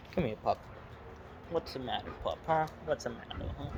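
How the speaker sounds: soft, coaxing pet talk; talking to a dog. Real, not acted. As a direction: gently soothe the whining pup. Comforting whining dog Jack.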